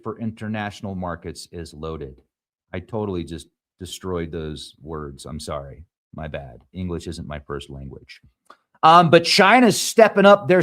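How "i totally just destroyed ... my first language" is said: much softer and lower, embarrassed aside; low, soft, self-conscious apology; sheepish apology. Real, not acted. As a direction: mumble a quick sorry, almost to yourself. Apologising for mangled names.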